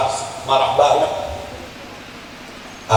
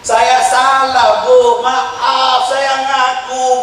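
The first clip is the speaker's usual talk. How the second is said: put-on pleading child; 7 semitones higher, much slower than usual. Child begging mother at door.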